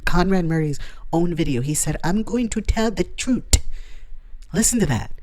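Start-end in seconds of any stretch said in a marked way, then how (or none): 2.0-3.6 "truth" ending in a hard T, like "troot"; mispronounced "truth". Retold or imitated. Vlogger quoting a doctor's video, "truth" said as "troot". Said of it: "He didn't say truth. He said".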